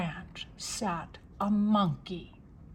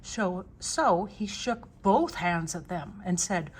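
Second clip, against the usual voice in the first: ordinary delivery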